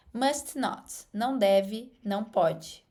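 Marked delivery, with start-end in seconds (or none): none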